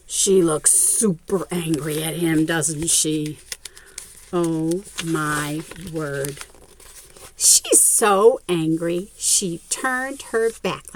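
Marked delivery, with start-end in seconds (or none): none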